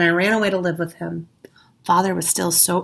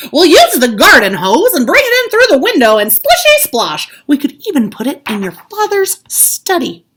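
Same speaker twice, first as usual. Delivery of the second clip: put-on high, loud, eager kid voice; bouncing with excitement; excited Ted; 8 semitones higher, 11 dB louder than usual. A narrator's reading. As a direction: sell the plan with glee. Ted voiced in read-aloud story.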